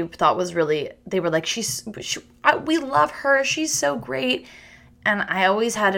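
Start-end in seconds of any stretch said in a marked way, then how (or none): 1.5-4.5 put-on breathy, high, gushing girl talk; gushing counselors. Counselors gushing over the cute camper, high and hushed. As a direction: coo over the favourite camper.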